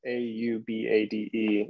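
usual speaking voice

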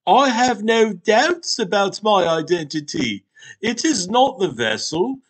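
grave talking Hot Pocket. Acted. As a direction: proclaim it like an ancient oracle. Hot Pocket insists on its identity.

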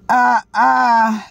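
drawn-out fake wail; hammed-up wail; mock wailing cry.

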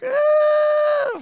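very high, loud teasing squeal; mock squeal of delight. Pastor mocking the driver who finally passes, high squeal. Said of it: "and you're like".

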